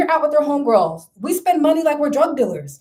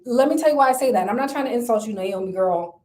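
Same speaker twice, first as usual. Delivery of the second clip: ordinary talk, nothing marked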